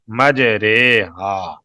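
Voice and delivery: "مجرے ہا" very slowly as maj-RAY-haa; majhool vowel. Shown as a demonstration. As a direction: stretch the ay and aa in "مجرے ہا". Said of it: "اس را کو پڑھیں گے".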